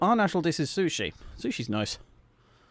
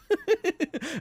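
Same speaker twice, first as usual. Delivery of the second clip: high-pitched burst of laughter; squeaky giggling fit; laughing; 13 semitones higher than usual. Real, not acted. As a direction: crack up helplessly, high. Cracks up after own British rant.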